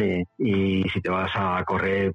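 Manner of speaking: ordinary talk, nothing marked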